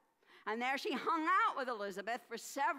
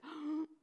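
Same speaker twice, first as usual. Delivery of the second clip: short gasp of fright; quick scared gasp; startled gasp; 5 semitones higher than usual. Acted. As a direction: gasp as if caught off guard. Mary startled by angel.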